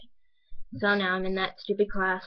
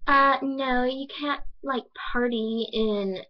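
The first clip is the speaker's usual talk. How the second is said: imitating a classmate, higher and haltingly slow; higher-pitched, dragging mock of a classmate; classmate's halting speech; 3 semitones higher, slower than usual. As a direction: mimic her drawn-out, filler-laden objection. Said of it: "this is literally how she speaks".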